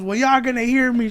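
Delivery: high, loud mock declaration; imitated defiant shout. Mother demanding the crowd hear her, mock high shout.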